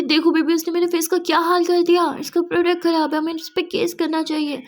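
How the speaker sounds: loud, high indignant whine; angry client complaining to her fiancé about her face; angry complaint. Acted. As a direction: whine angrily, demanding a lawsuit.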